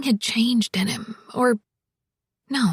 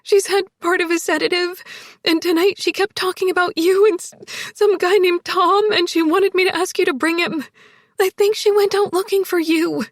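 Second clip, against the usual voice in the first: high, urgent, tearful rush; much higher, distressed woman's voice; crying; 8 semitones higher, 4 dB louder than usual. A narrator's reading. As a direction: explain urgently through tears. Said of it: "More crying".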